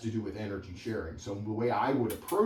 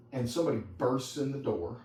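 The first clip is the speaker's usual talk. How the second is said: ordinary talk, nothing marked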